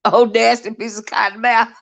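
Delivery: ordinary talk, nothing marked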